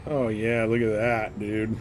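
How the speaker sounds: ordinary talk, nothing marked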